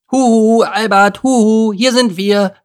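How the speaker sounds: high, loud singsong hail; excited calling. A narrator's reading. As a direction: call out to someone far off, excitedly. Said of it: "rief Miri und flatterte aufgeregt". (aufgeregt und hoch gerufen)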